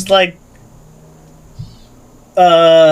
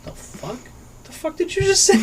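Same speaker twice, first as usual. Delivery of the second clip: shocked disbelief; 11 semitones higher, 5 dB quieter than usual. Retold or imitated. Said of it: "And I'm like".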